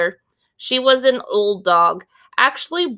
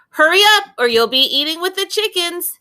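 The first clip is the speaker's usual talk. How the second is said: calling out; 9 semitones higher, faster than usual. Acted. Grandfather calls Willie to breakfast.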